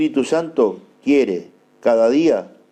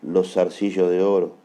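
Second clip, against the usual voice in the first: low, soft, solemn offering; hushed prayerful preaching; 5 semitones lower, 4 dB quieter than usual. Real, not acted. Preacher offers his ears to the Lord, hushed and prayerful. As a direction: lower the voice into quiet prayer, with pauses.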